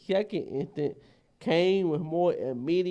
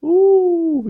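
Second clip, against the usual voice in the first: loud ooh; 12 semitones higher, 13 dB louder than usual. Real, not acted. Reacting to bite location.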